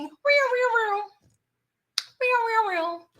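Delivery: high wailing siren noise; siren imitation. Mimicking a cop siren as the car gets pulled over, high wail.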